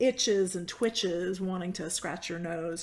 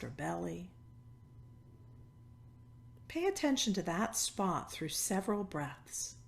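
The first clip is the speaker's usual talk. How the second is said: guided meditation; 4 dB quieter than usual. Acted. Yoga teacher settling a cancer-support class into stillness, guided meditation. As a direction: ease them into noticing, phrase by phrase, softening at the ends.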